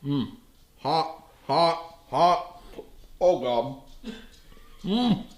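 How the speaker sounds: burning mouth, gasping hot; mouth full of hot food. Real, not acted. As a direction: talk round a scalding bite. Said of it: "before it scalded my tongue".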